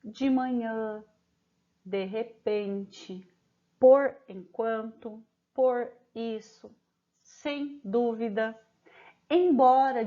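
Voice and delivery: each phrase slow and separate; teacher reading a word list to pupils; slow dictation. Shown as a demonstration. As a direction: dictate them slowly with pauses. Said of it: "O ditado de palavras como".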